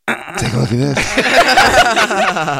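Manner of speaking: slow, drawn-out playful reveal through laughter; mock Louis reveal. Comedian acting Louis's apology, slow and laughing. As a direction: stretch it out slowly, laughing. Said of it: "He goes".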